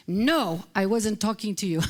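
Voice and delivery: lightly imitated Palestinian accent. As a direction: mimic the teacher's Palestinian accent a little. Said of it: "in his Palestinian accent".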